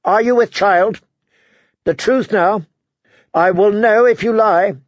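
sharp aunt's interrogation; sharply. A narrator's reading. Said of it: "she said sharply".

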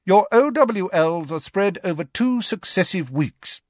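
high, crisp strict professor; stern teacher. Acted. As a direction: address the class with authority. McGonagall lectures the class.

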